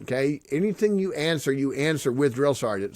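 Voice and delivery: usual speaking voice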